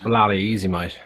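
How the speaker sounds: low, casual Australian accent. Shown as a demonstration. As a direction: toss it off in easy Australian. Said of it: "I can't do Australian".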